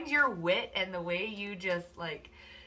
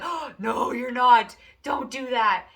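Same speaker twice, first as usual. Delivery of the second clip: mock alarmed protest; 6 semitones higher, 8 dB louder, slower than usual. People protesting the querent.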